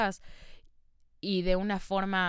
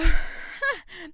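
laugh; 10 semitones higher, 7 dB louder than usual. Real, not acted. Bursts out laughing after crying.

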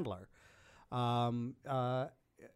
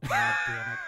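startled outcry; 4 dB louder than usual. Real, not acted. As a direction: cry out in sudden dismay. Caught off guard, crying out.

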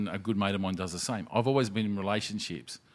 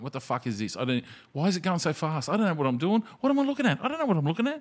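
rising to much higher, baffled panic; hammed-up bewildered newbie; mock confusion; 8 semitones higher, faster than usual. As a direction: climb higher and higher, lost on Twitter. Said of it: "I went".

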